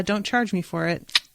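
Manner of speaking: ordinary talk, nothing marked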